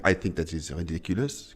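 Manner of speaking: a hint of a French mom, quiet; lightly imitating his French mother; French mom impression. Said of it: "I can impersonate her".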